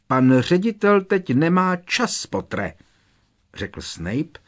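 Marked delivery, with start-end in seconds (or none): 0.1-2.7 unpleasant smile. Acted. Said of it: "nepříjemným úsměvem".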